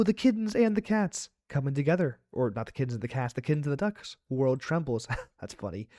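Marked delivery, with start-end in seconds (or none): none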